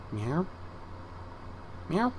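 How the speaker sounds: short, soft imitation meow; cat meow. Imitating cats in the cattery.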